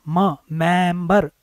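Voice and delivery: मेंबर drawn out very slowly after a lone म; slow sounding out. Shown as a demonstration. Teacher breaking a word into sounds. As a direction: isolate the म, then say मेंबर slowly. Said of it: "ए use होगा".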